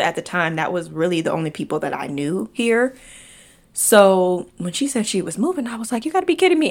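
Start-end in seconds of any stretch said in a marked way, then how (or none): 6.1-6.7 high, loud burst of disbelief; recreating her stunned reaction, voice jumping high; shocked disbelief. Retold or imitated. To her friend announcing the move, high shocked disbelief.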